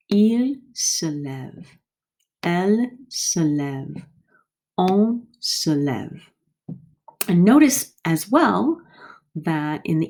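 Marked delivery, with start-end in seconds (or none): 0.1-6.2 slow, careful drill of il se lève, elle se lève, on se lève; slow conjugation drill. Shown as a demonstration. Teacher to class, slow careful model pronunciation. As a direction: spell out each form slowly. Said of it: "So we have".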